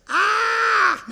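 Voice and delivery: long high loud shouted ah; giant's roar. Retold or imitated. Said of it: "All I've ever said was".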